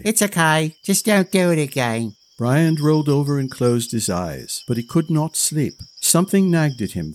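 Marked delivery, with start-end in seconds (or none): 0.0-2.1 put-on higher offhand child; indifferent. A narrator's reading. Boy to Brian, higher indifferent child. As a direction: brush him off. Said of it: "shrugged indifferently".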